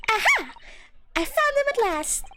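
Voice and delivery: high-pitched triumphant cry; excited high character voice; triumphant discovery. Acted. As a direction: burst out high with the find. Character triumphantly finds the notes.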